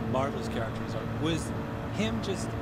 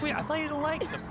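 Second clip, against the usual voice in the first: high, puzzled double take; partner's disbelief; 13 semitones higher than usual. Retold or imitated. Partner Kate catching his sudden change of heart, high bemused surprise. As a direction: question him as a bemused partner. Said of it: "She's like".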